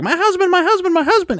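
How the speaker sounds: high-pitched mock scream of a distraught wife. Grown woman screaming in the street for her husband, high mock scream. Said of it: "like, screaming in the street".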